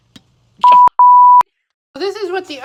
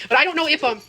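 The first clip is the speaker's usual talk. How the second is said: ordinary talk, nothing marked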